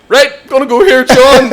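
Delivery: high, loud mock bravado; mocking a mate squaring up; mock tough guy. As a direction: ham up the hard man line.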